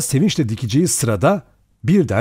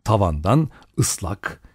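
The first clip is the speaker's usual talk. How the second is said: plain reading voice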